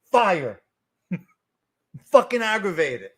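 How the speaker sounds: usual speaking voice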